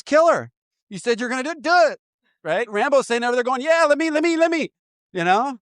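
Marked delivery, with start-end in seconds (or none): 0.0-2.0 high, urging mock executioner voice; egging him on to kill her. Executioner urging Rambo to kill, a high goading mock voice. 3.6-4.7 high, eager pleading voice; begging to be let loose; eager Rambo. Retold or imitated. Rambo begging the executioner to let him kill, eager high voice.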